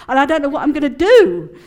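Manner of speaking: loud, laughing mock panic; half-laughed panicky wail; mock hissy fit. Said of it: "very sort of hissy-fitty".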